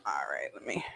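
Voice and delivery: breathy whisper to herself; a quick whispered aside. Real, not acted. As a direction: drop to a private whisper. Muttering to herself mid-cooking.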